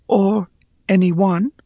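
high, pleading voice; pleading Rhea. Acted. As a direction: beg for help, reaching up.

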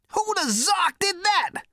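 very high, loud indignant yelp; Zaphod's left head. A narrator's reading. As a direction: squeal it in outrage. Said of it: "said the left head".

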